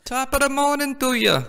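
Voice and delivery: high, hammed-up Irish brogue; mock leprechaun Irish voice; bad Irish accent. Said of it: "is that a horrible accent?".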